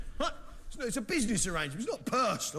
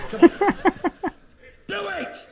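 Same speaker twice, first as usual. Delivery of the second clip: excited cheer; 14 semitones higher, 14 dB louder than usual. Real, not acted. Urging two women on stage.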